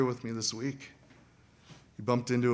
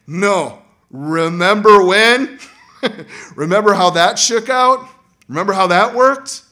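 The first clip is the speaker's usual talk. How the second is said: forceful preaching; 8 semitones higher, 14 dB louder than usual. Real, not acted. Preacher pressing the congregation, loud and forceful. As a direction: hammer each remember.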